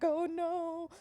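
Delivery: high, loud, drawn-out cry of dismay; hammed-up group panic; mock dismay. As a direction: wail it as a mock-horrified crowd. Boys react to shocking robot reveal.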